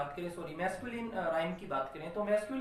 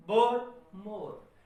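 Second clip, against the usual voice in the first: slow, hit बोर hard and ease off on मोर; strong बोर, weak falling मोर; stress on first word; 7 dB louder, much slower than usual. Shown as a demonstration. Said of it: "first stressed और second".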